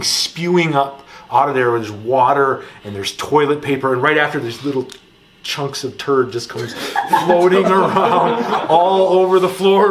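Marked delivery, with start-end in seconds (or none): 5.4-9.9 laughing through the words. Real, not acted. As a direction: crack up while telling it. Laughing at gross memory.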